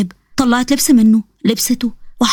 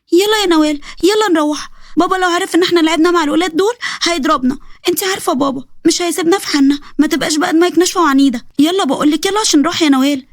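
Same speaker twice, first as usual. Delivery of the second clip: put-on higher child voice, urging her sister; a frightened kid pulling her twin home; scared little girl; 5 semitones higher than usual. Girl urging her twin sister to come home, high child voice. Ten-year-old girl voice.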